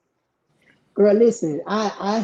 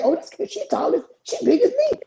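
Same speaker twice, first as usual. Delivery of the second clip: mock surprise; 11 semitones higher than usual. Mother eyeing the big bully girl, ironic high surprise. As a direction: pitch climbing high in ironic disbelief. Sarcastic shock at the bully's size.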